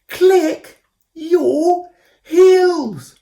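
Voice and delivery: high, very slow, very loud yell; yelling at full voice. A narrator's reading. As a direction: bellow each word up the tree. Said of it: "yelled Koo as loud as she could".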